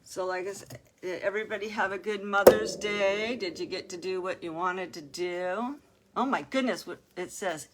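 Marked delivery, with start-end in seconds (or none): none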